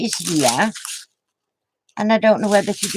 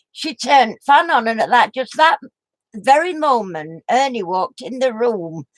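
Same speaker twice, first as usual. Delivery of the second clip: usual speaking voice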